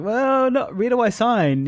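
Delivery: very high, mock-eager squeak; over-eager. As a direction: jump at the offer in a high voice. Acting out over-eager answer.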